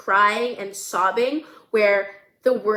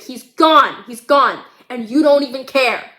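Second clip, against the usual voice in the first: loud, anguished repeated outcry; acted screaming grief; 3 semitones higher, 7 dB louder than usual. Grieving daughter accusing mother of not caring, acted screaming. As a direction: hammer each phrase out in acted anguish. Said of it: "She's screaming, crying".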